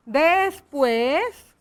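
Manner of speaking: "Después" drawn out, rising at the end; slow dictation. Shown as a demonstration. Teacher writes the agreed word, slow dictation. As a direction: stretch "Después" slowly for writing.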